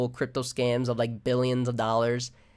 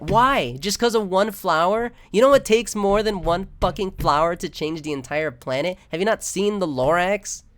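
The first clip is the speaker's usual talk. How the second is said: heated rant; 6 semitones higher, 5 dB louder than usual. Real, not acted. Ranting against naive hope.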